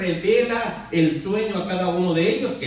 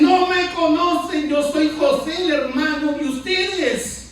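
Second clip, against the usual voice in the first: high, slow, choked-up voice as if weeping; a brother revealing himself while crying; tearful plea; 7 semitones higher, 5 dB louder, slower than usual. Acted. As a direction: plead through tears, pitch raised and dragged out. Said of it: "José rasga sus vestidos y llora y dice".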